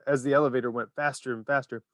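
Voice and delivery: ordinary delivery, nothing marked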